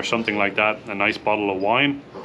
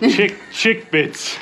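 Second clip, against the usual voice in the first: high, slow, playful name reading; playful drawn-out; 9 semitones higher, much slower than usual. Pretend. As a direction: stretch the name out, amused. Reading odd snack name aloud.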